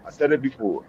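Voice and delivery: quietly repeating for emphasis. Real, not acted. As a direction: drop the repeat to a near murmur. Quiet repeat of his own point.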